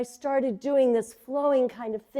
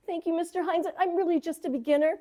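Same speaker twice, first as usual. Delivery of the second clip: starstruck gushing; 6 semitones higher than usual. Retold or imitated.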